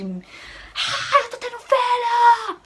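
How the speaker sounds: very high, loud, drawn-out yell; acted-out scream. Woman in labour crying out as birth comes, screaming. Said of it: "com muito medo de gritar".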